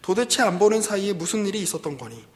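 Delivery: pressing interrogation. Acted. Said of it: "다시 또 다그칩니다". (딸을 다그치는 아버지처럼)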